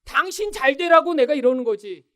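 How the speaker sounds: high, loud mock nagging; heaping blame. Wife pouring blame on her husband, acted angry nagging. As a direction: nag him as if for his own good. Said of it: "남편을 향해 막 비난을 퍼부으면서".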